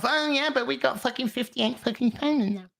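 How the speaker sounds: high, scornful mock fan voice; mocking dumb fans. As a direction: sneer it like a clueless fan. Mocking dumb fans' reply.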